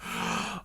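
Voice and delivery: sharp breathy mock gasp of pleasure; exaggerated gasp. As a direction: gasp in air like it's a treat. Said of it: "loudly breathing and moaning".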